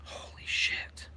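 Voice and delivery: awed whispered holy shit. Real, not acted. Streamer stunned after a mosquito flew in his ear, whispering. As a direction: breathe it out in shock. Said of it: "stunned after mosquito".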